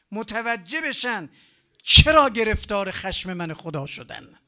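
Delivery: rising wrathful proclamation; stern warning. Retold or imitated. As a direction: thunder a divine warning. God's warning voiced in sermon.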